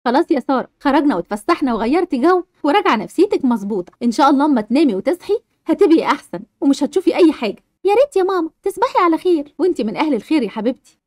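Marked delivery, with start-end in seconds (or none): 0.0-7.6 lower, soothing mum voice; calm the frightened child down; comforting mother. Acted. Mother soothing scared Sara, lower reassuring voice. 9.6-11.0 lower, soothing mum voice; calm the frightened child down; comforting mother. Acted. Mother soothing scared Sara, lower reassuring voice.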